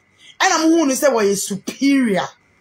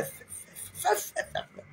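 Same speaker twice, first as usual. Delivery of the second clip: whispered fe sounds; 10 semitones higher, 14 dB quieter than usual. Retold or imitated. Mimicking baby sounds.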